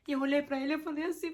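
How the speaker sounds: usual speaking voice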